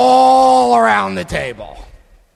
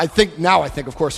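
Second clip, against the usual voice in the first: ordinary delivery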